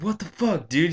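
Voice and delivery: high, sharp mock outburst; high retold what the fuck; imitating stepdad's outburst. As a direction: bark it like the angry stepdad. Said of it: "he's like".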